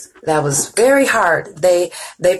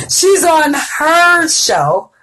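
slow, loud laughing call, pitched up high; laughing shout; 9 semitones higher, 4 dB louder, much slower than usual. Real, not acted. Host laughing as she tells viewers her sister is live. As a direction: laugh it out loud while announcing her friend. Laughing about friend's show.

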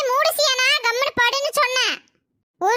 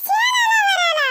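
shocked wail; 8 semitones higher than usual. Acted. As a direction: cry out in alarm. Shocked, crying out.

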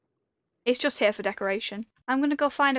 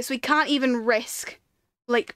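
ordinary delivery

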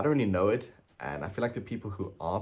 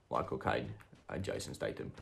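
Michael Caine impression; 6 semitones lower, 5 dB quieter than usual. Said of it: "No, that's Michael Caine".

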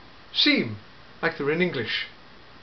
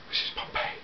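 whispered, much softer, dawning dread; hushed realisation; 12 dB quieter than usual. Acted. The Doctor realising where they landed, hushed whisper. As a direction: breathe it out as the truth sinks in.

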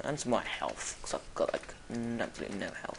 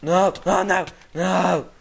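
loud, repeated cries of alarm; higher, loud panicked protest; panicked shouting; 4 semitones higher, 11 dB louder, slower than usual. Real, not acted. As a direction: shout in rising dismay. Panicking in game.

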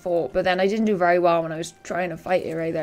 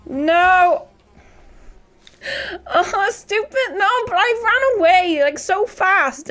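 high, loud shaken outburst; frantic relief; 10 semitones higher, 5 dB louder, slower than usual. Real, not acted. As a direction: blurt it out, still rattled. After escaping the monster.

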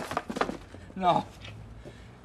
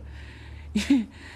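bitter laugh. Acted.

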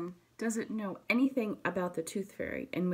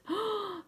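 sharp high gasp of shock; a quick startled intake of breath; 13 semitones higher than usual. Acted. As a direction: gasp as if seeing blood. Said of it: "Franklin was so startled".

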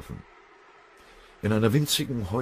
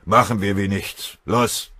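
loud, biting sarcasm; venomous; 4 semitones higher, 8 dB louder than usual. A narrator's reading. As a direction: throw it out loudly with venom. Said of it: "brummte Rosskamp giftig". (giftig und laut)

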